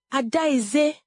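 high, sharp call of an angry mother; shout. A narrator's reading. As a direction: shout the daughter's name, pitched well up. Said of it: "she shouted".

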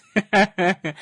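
short laugh breaking through; can't keep a straight face; burst of laughter. Real, not acted. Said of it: "I really tried to keep a straight face".

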